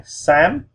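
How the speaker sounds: Xám swept high upward; rising tone. Shown as a demonstration. Repeating gray for learners, Xám going up high. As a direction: send Xám up like a question. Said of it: "we just go up".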